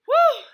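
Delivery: high, loud "whew" blown out; catching breath. A narrator's reading. Girl bent over catching her breath, loud whew. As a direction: puff out a big high whew. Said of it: "tried to catch my breath".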